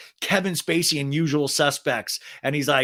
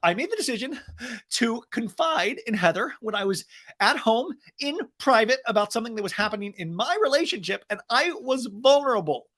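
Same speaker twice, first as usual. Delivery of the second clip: mock Shannon; 9 semitones higher than usual. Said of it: "he's like".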